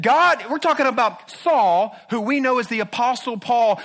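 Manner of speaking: preacher's astonished outburst; awed exclamation. Acted. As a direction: marvel out loud at the turn. Preacher exclaims over Saul.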